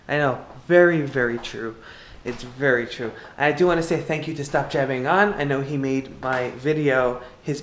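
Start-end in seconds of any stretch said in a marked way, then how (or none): none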